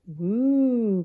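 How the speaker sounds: high, loud woo of the wind; the wind's high loud whoosh; howling wind. Acted. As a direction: howl like a winter gust. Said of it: "cried the wind".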